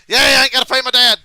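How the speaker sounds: can't believe his luck, loud and gleeful; excited outburst. Real, not acted. Streamer suddenly leads the race, loud and thrilled.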